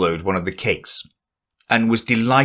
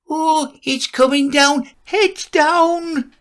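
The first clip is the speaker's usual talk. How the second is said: high, slow warning shout; alarmed cry; slower than usual. Acted. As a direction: cry out as it falls. Warning cry as roof falls.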